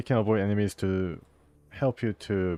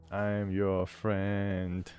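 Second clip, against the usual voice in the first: slow reading; much slower than usual. Acted. Reads dialogue option to dog.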